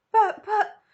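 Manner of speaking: high stuttering shriek; high loud stammering shriek. Acted. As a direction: sputter in panic. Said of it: "shrieked Missus Salt".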